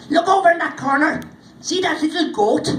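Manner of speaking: put-on high, loud little old man pointing; little man's voice. Little man showing the warriors his goat, high and loud. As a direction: pipe up like a small odd host.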